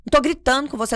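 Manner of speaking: loud, high protest, imitating an exasperated mother; shouted denial. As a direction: insist you're not shouting, at a near shout. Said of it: "Por que que você tá gritando?".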